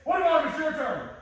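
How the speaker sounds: high, loud accusing yell about the dishes; mock angry shout. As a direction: shout back like picking a fight. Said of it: "expecting me to, like, come back".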